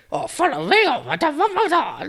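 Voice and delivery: bad high Jar Jar impression; Jar Jar Binks voice. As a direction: squeak it out like a clumsy alien. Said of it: "That's not how he sounds".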